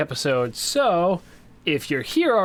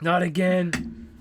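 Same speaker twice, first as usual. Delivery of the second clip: higher, drawn-out mock despair; mock dismay; 5 semitones higher, much slower than usual. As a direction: groan it slowly in pretend dread.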